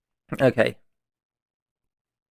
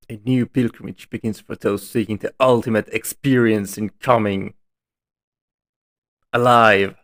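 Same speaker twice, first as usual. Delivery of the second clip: ad read. Acted. Reads in-game ad.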